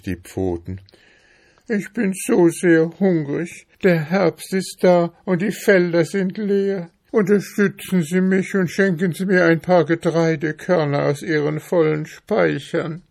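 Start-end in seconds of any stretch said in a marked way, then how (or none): 1.7-13.0 high-pitched, pleading little mouse; poor hungry mouse, pitched high; plaintive mouse. Acted. Mouse begs in the story.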